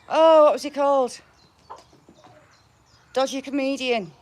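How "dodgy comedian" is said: normal talking voice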